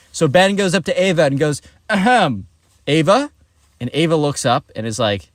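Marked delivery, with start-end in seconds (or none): 1.9-3.3 mock throat-clear, then a raised questioning name; politely fish for her attention; attention-seeking.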